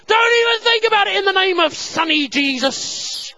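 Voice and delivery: high, loud hammed-up rebuke; full-volume silly threat; mock shouted command. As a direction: bellow it in comic mock-exorcism. Imagined mash-up of shouted commands.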